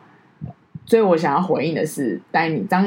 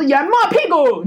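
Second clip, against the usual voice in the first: imagined angry retort; 10 semitones higher, 4 dB louder than usual. Retold or imitated. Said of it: "我一定是大打他巴掌".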